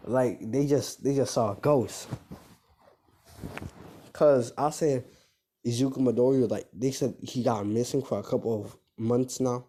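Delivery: plain reading voice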